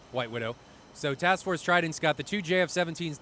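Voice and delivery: ordinary talk, nothing marked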